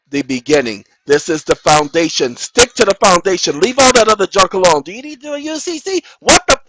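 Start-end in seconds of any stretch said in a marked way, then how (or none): 4.8-6.6 mock outrage. Mock question then outburst.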